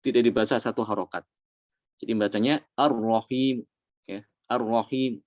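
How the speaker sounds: ordinary talk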